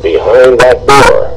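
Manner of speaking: ordinary delivery, nothing marked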